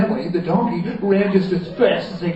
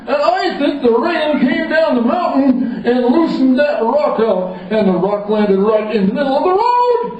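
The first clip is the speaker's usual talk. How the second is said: higher, louder royal reasoning; explaining king; 9 semitones higher, 4 dB louder than usual. Acted. The king explains the rainstorm to the wizard, pompous. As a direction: lay out the case pompously.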